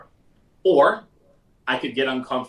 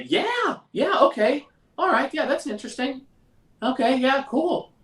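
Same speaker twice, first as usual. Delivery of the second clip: much higher, hesitant yeah-yeah prospect voice; high-pitched voice; 12 semitones higher than usual. Retold or imitated. Prospect hearing the price, answering in a high-pitched voice. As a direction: squeak out a nervous, uneasy agreement. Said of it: "get that high-pitched voice".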